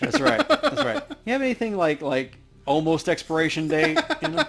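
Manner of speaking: loud, high playful joking; mock eager bargain hunter; playful excitement. Playful haggling joke.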